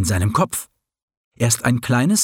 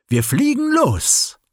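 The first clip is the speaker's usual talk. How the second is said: high, rasping owl call; 12 semitones higher than usual. Acted. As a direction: rasp it out like an owl taking off. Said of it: "schnarrte die Eule".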